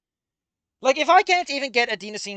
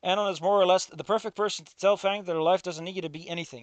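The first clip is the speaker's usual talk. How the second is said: usual speaking voice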